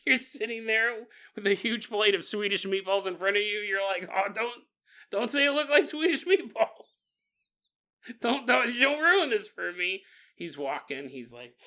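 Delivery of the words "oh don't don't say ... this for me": high, loud whiny protest; mock pleading. Imagined listener protecting their meatball dinner, mock pleading. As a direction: beg in a hammed-up, raised voice.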